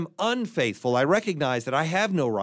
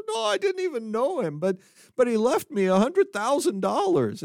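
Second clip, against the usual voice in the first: a higher, whiny blubbering voice; weeping man; 8 semitones higher than usual. Acted. Crying man admits he never knew his uncle, whiny and high. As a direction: wail it like a sobbing grown man. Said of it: "He says".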